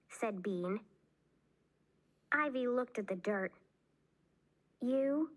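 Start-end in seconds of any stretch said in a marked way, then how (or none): none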